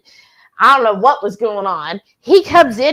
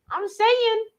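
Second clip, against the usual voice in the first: usual speaking voice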